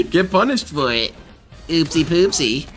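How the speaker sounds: high, slow goofy clown taunt; silly clown voice. Acted. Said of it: "being a weird clown voice".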